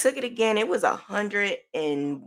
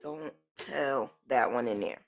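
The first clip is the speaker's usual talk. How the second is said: hushed warning; 7 semitones lower, 8 dB quieter than usual. Retold or imitated. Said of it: "I'm like".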